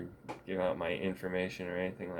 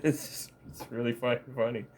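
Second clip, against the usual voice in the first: usual speaking voice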